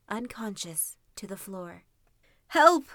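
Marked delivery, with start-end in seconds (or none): 2.5-2.8 loud, high cry for help; calling for help. Acted. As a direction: shout for help in alarm. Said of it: "called the old woman".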